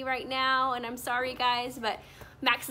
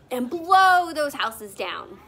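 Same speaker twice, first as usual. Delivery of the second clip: louder, puffed-out wolf blow; big bad wolf blowing; 10 dB louder than usual. Shown as a demonstration. Teacher playing the wolf for the kids, loud blowing words. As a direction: blow the houses down with a big puff. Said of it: "pretend to be the big bad wolf".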